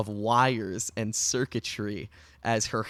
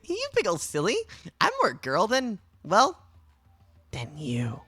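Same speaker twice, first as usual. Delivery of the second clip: put-on playful AI girl; 8 semitones higher than usual. GM voices the AI character.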